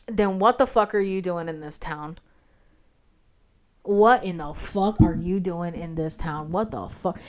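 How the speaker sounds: low, soft, baffled muttering to oneself; low-key disbelief. Retold or imitated. Applicant baffled by out-of-town interviewer, muted disbelief. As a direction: drop low and quiet, dumbfounded.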